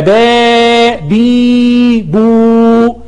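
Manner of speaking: باء بي بو each vowel stretched very slowly; long vowels. Shown as a demonstration. Teacher demonstrating the three long vowels to the class, slow and stretched. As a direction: draw out باء, بي and بو one by one, slow and held. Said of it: "أطول الكسر القصير تبقى إي".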